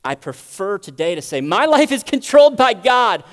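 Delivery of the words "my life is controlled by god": loud, high, slow proclamation; emphatic declaration. Shown as a demonstration. Preacher declaring whom he prefers in control, loud proud proclamation. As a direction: proclaim it slowly at full force.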